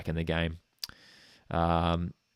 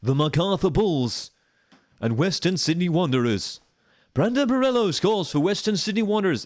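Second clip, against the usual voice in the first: Robbie Thompson impression; 10 semitones higher, 4 dB louder than usual. Mock English commentator voice calling a Wanderers goal for a friend. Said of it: "that's a terrible Robbie Thompson impression".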